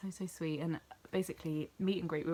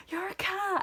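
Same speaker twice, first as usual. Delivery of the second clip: high, loud squeal of delight; excited greeting; 6 dB louder, slower than usual. Retold or imitated. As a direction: echo her thrilled surprise at the name. Kat greets her excitedly.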